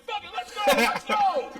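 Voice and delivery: reckless hype. Retold or imitated. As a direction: throw caution away, hollering. Drunken bravado on stage.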